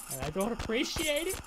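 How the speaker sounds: high-pitched, put-on wounded complaint; squeaky mock indignation; mock-hurt. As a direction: play up hurt feelings in a high voice.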